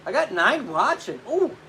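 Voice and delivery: usual speaking voice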